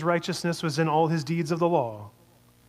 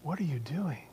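tender question; 8 dB quieter than usual. Acted. Jesus speaking to Saul.

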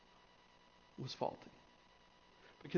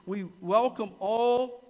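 loud, raised, stretched-out declaration; emphatic preaching; 6 semitones higher, 7 dB louder than usual. Acted. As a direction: ring out each welcome, leaning on the last word. Emphatic recital of church welcome.

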